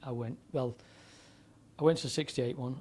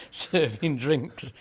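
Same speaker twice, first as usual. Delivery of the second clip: laughing; 2 semitones higher, 8 dB louder, much slower than usual. Real, not acted. Laughing at his own lucky break.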